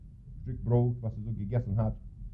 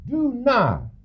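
stern rebuke; 12 semitones higher than usual. Acted. God's harsh word to the fool.

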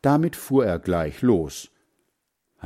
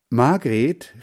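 louder, raised call of a name; 4 semitones higher, 6 dB louder than usual. A narrator's reading. Hans Frieder to his sister arriving at the gate, calling out. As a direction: call to someone a little way off. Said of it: "rief Hans Frieder".

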